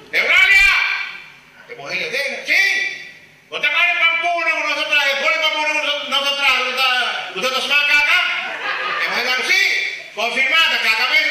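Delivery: very high, loud furious voice; hammed-up wife yelling; shrill angry wife. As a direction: shriek at him like an outraged wife. Wife voiced shouting in story.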